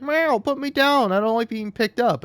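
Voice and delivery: high whiny cat complaining at being lifted. Retold or imitated. Cat voiced complaining when picked up.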